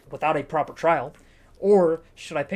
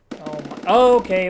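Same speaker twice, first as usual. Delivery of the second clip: loud, higher startled okay; startled yelp; 3 semitones higher, 9 dB louder, slower than usual. Real, not acted. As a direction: jump at the noise. Sudden noise in the game.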